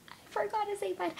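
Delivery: high, soft little whine; tiny high-pitched regret; mock wail. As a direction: squeak out a small mock lament. Mock lament over missed goodbye.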